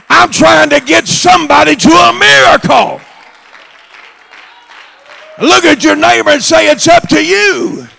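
loud, high revival shouting; full-force preaching. Acted. Preacher urging congregation toward a miracle, full-force shouting. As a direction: drive each phrase harder, climbing in pitch.

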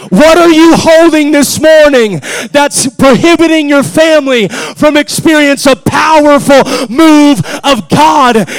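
loud, rising pulpit exhortation; full-force preaching. Real, not acted. Preacher urging the church to break chains, full-force preaching. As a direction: preach it hard to the congregation.

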